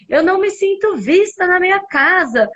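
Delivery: high-pitched mock yell; retold yelling. Mother protesting to the kids in her house, retold shout. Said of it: "no meio da gritaria".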